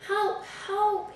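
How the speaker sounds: high, lightly voiced call for help; a policeman's playful help call; storybook cry of help; mock cry for help. A narrator's reading. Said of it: "he said".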